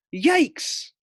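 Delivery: high squeaky yelp of fright; startled yelp. Acted. Guinea pig startled by the waiting giant. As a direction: jump out of your skin.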